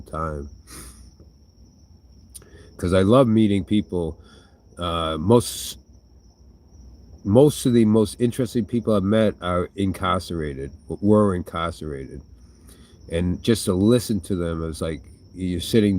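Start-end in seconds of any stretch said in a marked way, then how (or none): none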